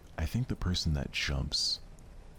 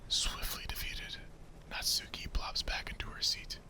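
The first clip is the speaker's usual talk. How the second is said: soft breathy whisper telling the story; whispered narration; 6 dB quieter than usual. Acted. Narrator setting the club scene, whispered. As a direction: hush it like a secret bedtime story.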